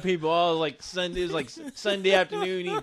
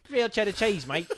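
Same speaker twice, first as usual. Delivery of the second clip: laughing mock-Aussie salesman; Australian accent. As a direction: put on an amused Australian accent. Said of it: "I'm doing an Australian accent".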